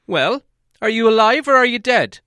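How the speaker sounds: loud, high, cross demand. A narrator's reading. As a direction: snap it at him, fed up and impatient. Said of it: "asked Bilbo quite crossly".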